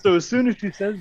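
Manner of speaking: can barely get it out for laughing; cracking up while telling; laughing through it. Real, not acted.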